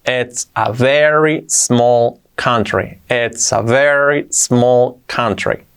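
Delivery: "It's a very small country" drawn out word by word; very slow teaching delivery of "It's a very small country"; slow model. Shown as a demonstration. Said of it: "Así sería".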